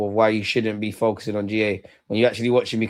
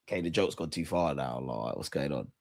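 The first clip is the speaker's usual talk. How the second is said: despairing mutter; 9 semitones lower, 7 dB quieter than usual. Retold or imitated. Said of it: "I was like".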